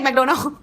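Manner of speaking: "McDonald" with a long "oh" in the middle; mispronounced McDonald. Shown as a demonstration. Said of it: "I would say McDonald".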